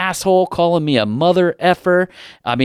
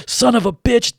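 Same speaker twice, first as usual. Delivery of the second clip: higher, a hammed-up furious curse; mock cursing; 9 semitones higher than usual. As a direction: spit it out like the angry customer. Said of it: "starts mother effing me again".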